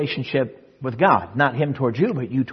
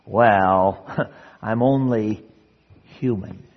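low, slow sheepish excuse with a little laugh; mock self-justifying excuse; 4 semitones lower, much slower than usual. Believer justifying blowing it regularly, mock sheepish excuse. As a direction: shrug it off haltingly, imitating an excuse-maker. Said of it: "we have a phrase to justify ourselves".

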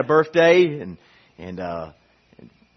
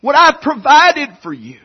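high, loud entreaty; God pleading; 8 semitones higher, 6 dB louder than usual. Acted. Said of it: "God says".

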